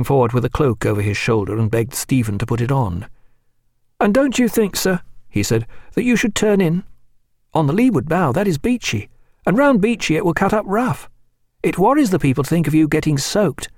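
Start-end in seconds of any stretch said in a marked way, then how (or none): 4.0-5.0 put-on higher, eager young lieutenant; lightly voiced keen young officer. A narrator's reading. Reed voiced in narrated dialogue. 5.9-13.7 put-on higher, eager young lieutenant; lightly voiced keen young officer. A narrator's reading. Reed voiced in narrated dialogue.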